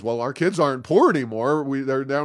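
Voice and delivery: ordinary delivery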